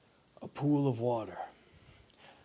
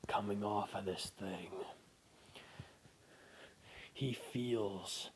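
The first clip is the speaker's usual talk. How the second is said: slow unvoiced whisper narration; tense whispered storytelling; hushed horror narration. A narrator's reading. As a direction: whisper the dread.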